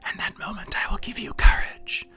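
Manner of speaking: low quiet voice. A narrator's reading. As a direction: whisper like a voice from the fire. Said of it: "the voice said".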